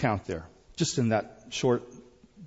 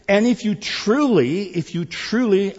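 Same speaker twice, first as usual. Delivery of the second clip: "truly" hit high and loud; stress on truly; 9 semitones higher, 7 dB louder than usual. Real, not acted. Preacher underlining his point to church, "truly" punched high. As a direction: punch "truly" each time, voice raised. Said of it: "underline truly again".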